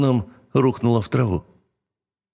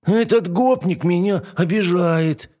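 higher, slow tattling whine; whiny dragon; 10 semitones higher than usual. A narrator's reading. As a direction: tattle like a hurt little dragon. Dragon complains to princess.

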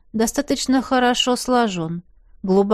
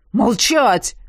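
jailer's bark. Acted. Jailer orders silence.

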